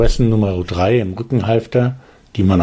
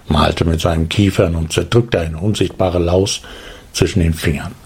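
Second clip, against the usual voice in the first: ordinary delivery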